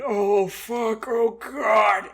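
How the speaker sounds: loud, high cry of dismay; mock horrified shout. Traveler running into a goblin village, horrified shout. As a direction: yell it out in comic horror.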